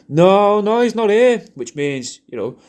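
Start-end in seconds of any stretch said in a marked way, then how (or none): 0.1-1.4 higher, louder hurried call back; hammed-up shout down the stairs; mock shouted reply. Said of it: "you can shout, going".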